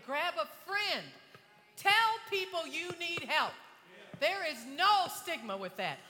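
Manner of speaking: loud, high-pitched preacher's exhortation; full-force preaching. Real, not acted. Preacher urging young people not to give up, full-force preaching. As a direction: cry it out to the whole congregation, shouting the key words.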